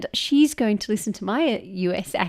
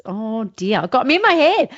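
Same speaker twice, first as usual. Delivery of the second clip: ordinary delivery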